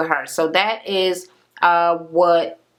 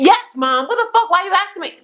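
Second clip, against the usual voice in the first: going off; 12 semitones higher than usual. Retold or imitated. Daughter blowing up at her mother on the phone, angry. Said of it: "she went the fuck off".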